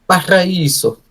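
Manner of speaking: high, loud fake Spanish accent; terrible Spanish accent. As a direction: belt it out in a hammed-up Spanish accent. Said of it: "that was a terrible Spanish accent".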